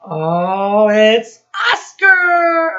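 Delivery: hammed-up big reveal for children; excited reveal. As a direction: unveil the surprise guest with delight. Teacher reveals the puppet visitor.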